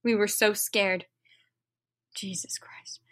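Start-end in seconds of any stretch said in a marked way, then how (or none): 0.0-1.1 low, measured narrator voice; understated storybook narration; story reading. A narrator's reading. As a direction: read it out lower and softer than chat. Reads fan fiction aloud.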